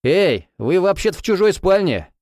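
high indignant shout. Acted. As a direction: yell at the intruders. Shouting at drunk couple.

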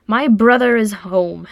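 proud, slow declaration. A narrator's reading. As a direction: boast about your brother. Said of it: "said Oin proudly".

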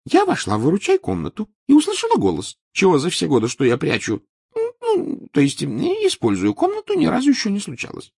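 high, airy eccentric seer; lofty old prophetess voice; Trelawney voice. Acted.

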